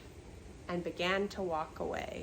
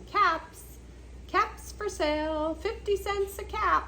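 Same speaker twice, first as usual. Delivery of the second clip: calling out wares; 9 semitones higher than usual. Acted. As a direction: call out to buyers down the street. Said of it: "he walked back to town calling".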